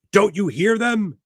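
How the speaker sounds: high loud anguished cry. Acted. Narrator on point of crying.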